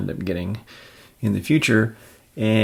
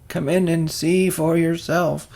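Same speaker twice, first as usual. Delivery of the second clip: put-on higher, slow old-woman voice; old lady voice; 9 semitones higher, 4 dB louder, slower than usual. Mrs. Watson inviting visitors in, old-woman voice. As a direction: invite them in like a kindly elder.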